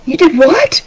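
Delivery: high, startled mom voice; high-pitched motherly disbelief; surprised mother. Acted. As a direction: react as an astonished parent. Mother answers the boy's news.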